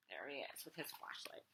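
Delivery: usual speaking voice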